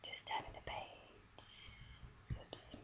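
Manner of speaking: usual speaking voice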